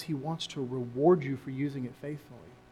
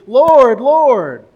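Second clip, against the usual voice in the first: loud, high pleading call; shouted fervent appeal to Jesus; emphatic cry of Lord; 10 semitones higher, 20 dB louder than usual. Retold or imitated. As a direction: cry it out like a devoted follower. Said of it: "who doesn't go to Jesus and say".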